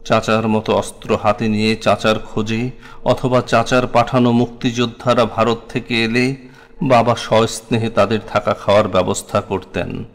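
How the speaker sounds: plain reading voice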